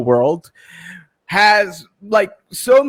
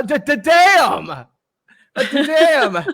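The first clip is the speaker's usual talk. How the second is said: excited; 6 semitones higher, slower than usual. Real, not acted. Sees old photos.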